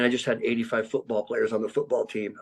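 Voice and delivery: normal talking voice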